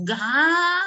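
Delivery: loud, high ga drawn out; stretched shout. Shown as a demonstration. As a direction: belt out ga. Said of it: "O su".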